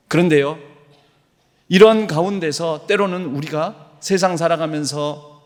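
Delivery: preaching. Acted. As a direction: preach it with conviction to the congregation. Preacher's rising emphatic passage.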